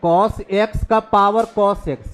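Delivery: very slow, word by word dictation. Shown as a demonstration. Teacher dictating a formula to the class, slow and deliberate. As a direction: spell out the formula slowly for students.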